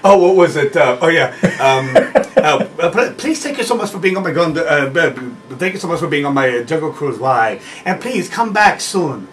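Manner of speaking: mock the Hong Kong guide's sign-off, giggling; Hong Kong accent. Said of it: "And he's like".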